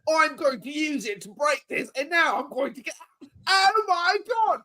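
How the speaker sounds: put-on high, overexcited kid gamer voice, pitched well up; mocking a Minecraft YouTuber. Dad mocks his son's Minecraft YouTuber, high and overexcited. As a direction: ham up a squealing English kid streamer. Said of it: "the English kid with the horrible accent".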